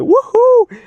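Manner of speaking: hammed-up high cheer; mock excited woohoo. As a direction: squeal it like a hopeful kid. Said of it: "And I'm like".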